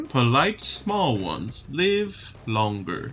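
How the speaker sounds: slow, weighty giant speech. Acted. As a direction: speak as a slow old giant weighing each word. Giant speaks to the party.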